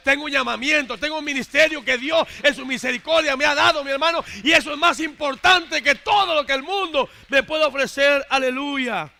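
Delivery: loud, rising proclamation of his calling; fiery preaching. Acted. Preacher proclaims his calling above worldly things, loud and fervent. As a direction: preach it out with full force.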